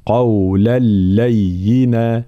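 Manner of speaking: قولا لينا very slow, no nasal sound; idgham without ghunna. Shown as a demonstration. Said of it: "هكذا". (قولا لينا بإدغام بلا غنة ببطء)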